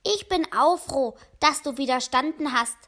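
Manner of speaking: plain reading voice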